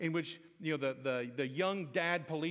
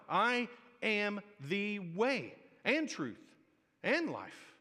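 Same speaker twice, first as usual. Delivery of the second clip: slow, drawn-out emphatic declaration; slow preaching emphasis; 4 semitones higher, much slower than usual. Acted. Pastor proclaiming Jesus' words slowly to the congregation. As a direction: let each word land slowly.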